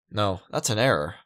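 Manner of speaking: ordinary talk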